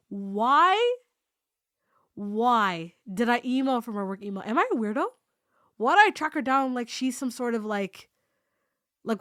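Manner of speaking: loud, agonised self-questioning; retold panic. Berating himself over the work email, panicking. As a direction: beat yourself up in a mounting panic. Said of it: "I was panicking".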